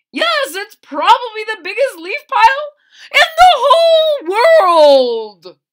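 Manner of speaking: very high, loud, laughing bird voice; laughing blue jay. Acted. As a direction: crow it out with a laugh.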